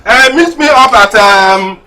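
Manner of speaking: high, loud call to someone far off. Acted. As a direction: shout the meeting place across. Calling wife to meet.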